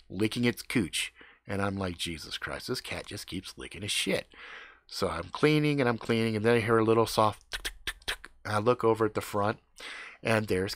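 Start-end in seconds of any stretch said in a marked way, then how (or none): 2.0-4.3 disgusted mutter. Retold or imitated. 7.6-8.3 whispered tick tick. Retold or imitated. Imitating soft tapping.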